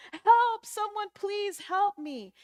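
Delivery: high, loud drawn-out plea; acted scream, stretched on the plea; screaming for help. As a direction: call out desperately for rescue. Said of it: "he starts screaming".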